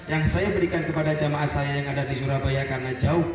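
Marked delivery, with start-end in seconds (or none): none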